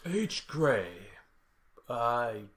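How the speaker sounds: slow therapist impression, lightly put on; Olin impression. Shown as a demonstration. Castmate asked for an Olin impersonation, reluctant slow impression. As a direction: draw it out as a half-confident impersonation. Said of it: "hear all of your guys' Olin impersonations".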